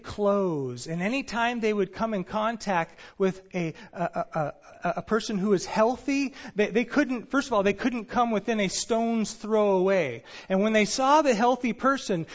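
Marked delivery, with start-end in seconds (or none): none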